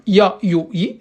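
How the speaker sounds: the ya said as a consonant in ya, yo, yi, each syllable short and clear; syllable drill. Shown as a demonstration. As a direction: spell out the ya with each short vowel. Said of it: "Le ya, pareil". (épeler ya, yo, yi syllabe par syllabe)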